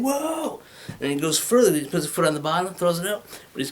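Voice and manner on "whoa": high rocker whoa. Shown as a demonstration. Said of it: "the [name] thing".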